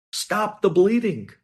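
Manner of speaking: usual speaking voice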